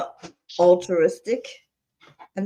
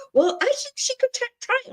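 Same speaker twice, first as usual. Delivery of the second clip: high, hesitant imitation; high wavering mock voice. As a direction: waver like an unsure host. Mocking wavering host.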